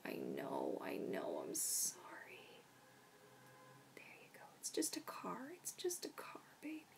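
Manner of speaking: soft whispered comfort; gentle whisper; soothing whisper. Real, not acted. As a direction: hush the dog about the car. Whispering to scared dog.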